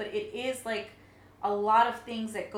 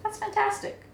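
delighted; 11 semitones higher, 4 dB louder than usual. Real, not acted. Reacting with delight.

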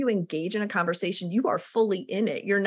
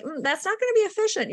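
knowing verdict; 11 semitones higher, faster than usual. Retold or imitated. Inner verdict on a vague schedule.

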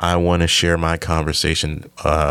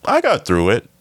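mock relief; 5 semitones higher, 4 dB louder than usual. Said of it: "it's not even one of those".

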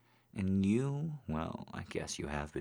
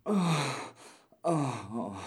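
sharp high moaning; high loud moans; 9 semitones higher, 7 dB louder, slower than usual. Acted. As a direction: cry out in pleasure. Moaning in climax.

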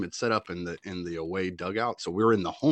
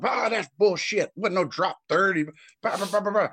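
high, mocking whiny rant; hammed-up, slowed whine of an angry coach; 10 semitones higher than usual. Mocking the other team's coach complaining. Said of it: "here comes the coach from the other dugout".

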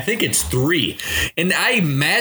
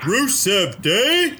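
incredulous; 7 semitones higher, much slower than usual. Real, not acted. Incredulous at the pick.

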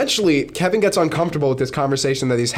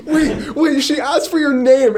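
squeaky laughing question; 11 semitones higher, 4 dB louder than usual. Real, not acted. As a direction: talk through high laughter. Friend laughing at the story.

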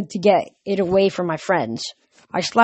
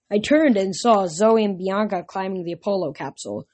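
usual speaking voice

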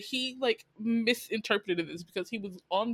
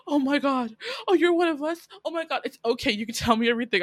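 giddy excitement; 6 semitones higher, faster than usual. Retold or imitated. Coworker thrilled she shares his secret, giddy and eager. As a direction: gush like you've just found your people. Thinks she's one of them.

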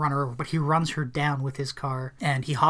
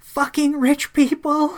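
slow, high, loud angry yell; imitating her slow furious yell; mock yell; 11 semitones higher, 8 dB louder, slower than usual. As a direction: spit it out at the driver. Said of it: "she yells something like".

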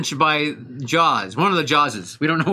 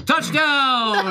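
mock touchdown call; 7 semitones higher than usual. Joking about Miami Dolphins.

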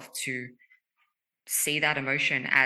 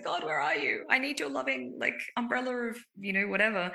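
much higher, whiny mock plea; pleading with God; 10 semitones higher than usual. Speaker mocking her own plea to God, whiny and high. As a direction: beg God for comfort, half joking. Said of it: "I'm always like".

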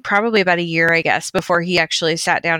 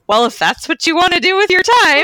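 higher, dry offhand imitation; imitating Ruth; 10 semitones higher, 4 dB louder than usual. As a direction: voice her shrugging it off. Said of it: "her exact words were".

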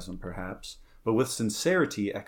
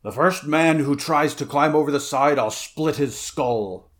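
raised, forceful warning, slowing at the end; threatening; 4 semitones higher, 6 dB louder than usual. A narrator's reading. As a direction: threaten them as captain. Conan threatens the men climbing aboard.

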